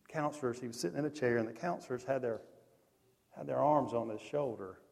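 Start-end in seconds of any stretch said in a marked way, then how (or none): none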